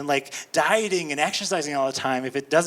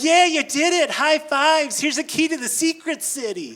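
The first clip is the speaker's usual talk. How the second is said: cheering; 8 semitones higher than usual. Retold or imitated. Imagined American cheering.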